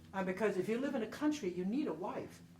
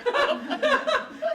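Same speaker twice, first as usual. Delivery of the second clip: loud high laugh; 12 dB louder than usual. Real, not acted. Woman laughing loudly as the table joins in.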